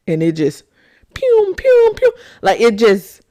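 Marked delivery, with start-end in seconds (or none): 1.1-2.1 high loud pew pew sound effect; playful zap noises; mock blaster sounds. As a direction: fire off the stories like lasers. Sound effect for stories coming.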